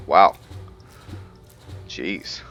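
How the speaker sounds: usual speaking voice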